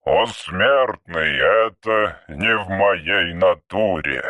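low, very slow archaic voice; an old machine speaking in verse; ancient robot. Acted. (низкий медленный голос древнего робота)

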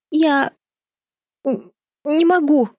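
put-on high, halting little-girl voice; stammering. A narrator's reading. Чарли balks at jumping from the window, stammering fearfully. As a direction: stumble over the words, scared to go on. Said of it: "запинаясь, проговорила та".

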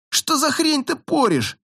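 high, slow furious outburst. A narrator's reading. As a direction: spit it out in a rage. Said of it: "Джей был вне себя от ярости".

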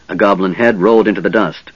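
usual speaking voice

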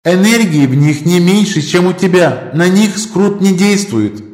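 high light female voice; Мэлла's voice. Acted. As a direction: voice inside his head. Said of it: "голос Мэллы".

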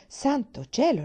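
a mother amazed at the request; surprised mother. A narrator's reading. (stupore della madre)

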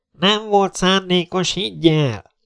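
slow, high-pitched insistence projected outward; blaring reply. Acted. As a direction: call back at once, stretching the words. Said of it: "harsant nyomban a válasz". (harsányan visszakiáltva)

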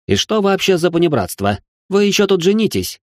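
выше, чопорно и обиженно; отстаивай честь высшего общества; возмущённый аристократ. A narrator's reading. Richard exasperated. (аристократ Ричард спорит с Салихом, выше и обиженно)

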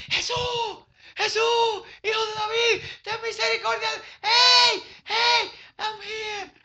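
very high, drawn-out calls; crying out. Acted. Blind man calls Jesus for mercy, high wailing cry. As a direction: wail the name like a far-off cry for help. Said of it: "Gritó, gritó".

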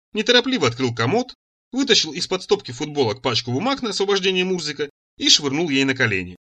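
plain reading voice

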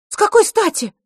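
very high, loud protest; indignant. A narrator's reading. Girl objecting to being taken away, indignant. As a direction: demand a reason in outrage. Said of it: "возмутилась девочка".

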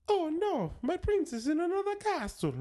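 very high falsetto plumber; cartoon Italian plumber way up high; Mario. Host having his try at the same line, high plumber impression. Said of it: "I'll try it".

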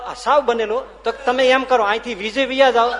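loud, high, laughing delivery; laughing punchline. Real, not acted. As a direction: burst out with the joke's payoff. Punchline of the latrine joke. (હસતાં હસતાં જોરથી વાર્તાનો અંત)

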